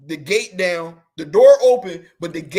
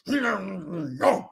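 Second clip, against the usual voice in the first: mock angry drawn-out growl; imitated growl. Comic mimicking angry store workers ending a sentence, imitated growl. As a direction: growl it out, mimicking someone mad. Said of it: "they growl it out".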